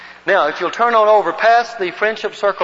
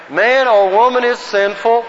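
higher, measured recitation for a group; group recitation lead; 5 semitones higher, 4 dB louder than usual. Shown as a demonstration. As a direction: declaim it so everyone can join in. Leads group reciting in unison.

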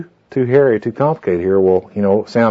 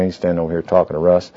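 ordinary talk, nothing marked

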